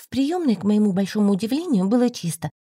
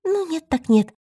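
breezy shrug; 7 semitones higher than usual. A narrator's reading. Girl shrugs off refusal.

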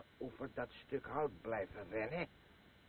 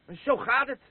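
much higher and loud, proclaiming; loud declaration; 10 semitones higher, 10 dB louder than usual. Acted. Drunk man proclaiming to his old friend, loud and high. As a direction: slam home the moral of the story.

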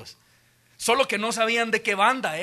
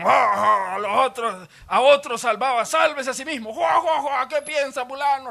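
higher, loud mocking chuckle of a smug priest; laughing fat priests; 5 semitones higher, 3 dB louder than usual. Smug priests laughing at Jesus on the cross, gloating.

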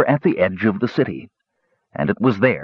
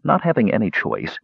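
ordinary delivery, nothing marked

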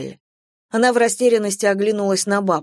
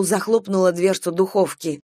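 ordinary delivery, nothing marked

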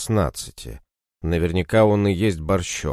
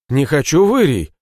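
tearful cry; 7 semitones higher, 5 dB louder than usual. Acted. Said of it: "и расплакался".

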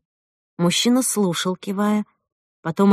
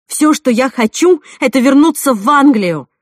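raised, heated protest; angry insistence; 5 semitones higher, 8 dB louder than usual. Acted. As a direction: hammer it out in anger. She refuses angrily, before lowering voice.

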